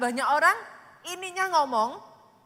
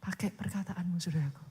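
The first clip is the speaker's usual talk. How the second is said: normal talking voice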